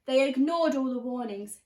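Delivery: plain reading voice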